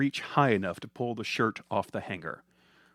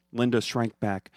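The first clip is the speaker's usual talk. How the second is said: ordinary delivery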